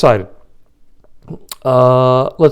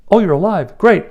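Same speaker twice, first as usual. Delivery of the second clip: mock-delighted, higher voice; happy relief; 7 semitones higher than usual. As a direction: act out pleased surprise, pitched up. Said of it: "now he's pretty happy because".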